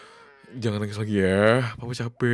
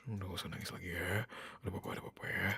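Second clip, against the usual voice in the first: low, very soft near-whisper; hushed comforting; 4 semitones lower, 16 dB quieter than usual. Acted. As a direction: lull the crying child with quiet reassurance. Father soothes child to quiet. (membujuk lembut, hampir berbisik)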